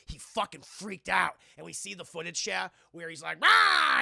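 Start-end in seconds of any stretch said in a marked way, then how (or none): 3.4-4.0 much higher, much louder hammed-up roar; mock roar. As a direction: lunge with a roar. Acting possessed farmer's attack.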